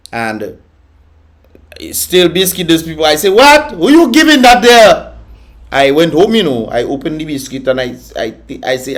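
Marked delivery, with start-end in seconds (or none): 3.3-5.2 high, loud outraged question; indignant shout. Retold or imitated. Retelling the stale biscuit, indignant shout. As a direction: shout at the shopkeeper in disbelief. Retelling complaint at Chinese store.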